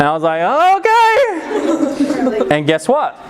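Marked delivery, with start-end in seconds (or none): 0.8-2.5 very high, stretched squeak; hammed-up helpless giving in; mock panicky. Kid agrees to graduate early against his mind, panicky squeal. Kid nervously accepts the plan.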